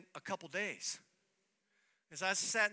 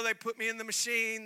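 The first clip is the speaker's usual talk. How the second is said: normal talking voice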